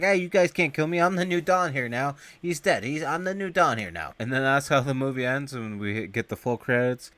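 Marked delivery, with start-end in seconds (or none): 0.0-4.1 cocky boast. Retold or imitated. Kid voiced, claiming power.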